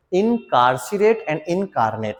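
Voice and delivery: both words said very slowly; slow contrast. Shown as a demonstration. Teacher contrasts two homophones very slowly. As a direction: draw out incarcerate and incarnate clearly. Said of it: "इन दोनों words के बारे में".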